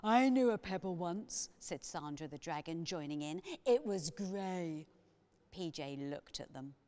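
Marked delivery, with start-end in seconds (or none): 0.0-1.5 loud, ponderous dragon voice; dragon, earnest and loud; Sandra the dragon. A narrator's reading. 3.7-4.9 loud, ponderous dragon voice; slow and solemn, like a dragon; dragon, earnest and loud; Sandra the dragon. A narrator's reading.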